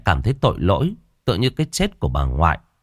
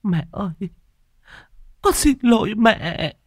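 choked sobbing; 14 semitones higher than usual. Acted. Daughter sobbing apology at the altar, choked. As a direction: beg forgiveness through sobs. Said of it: "mà nấc nghẹn ngào".

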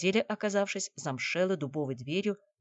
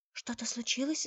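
higher, softly alarmed question; startled worry; 7 semitones higher, 3 dB quieter than usual. A narrator's reading. Наташа asks Алексей what happened, mildly frightened. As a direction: ask with a touch of fear. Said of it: "испугалась Наташа".

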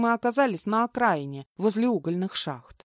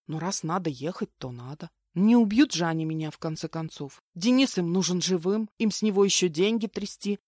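usual speaking voice

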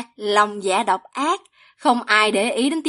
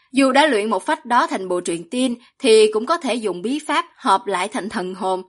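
usual speaking voice

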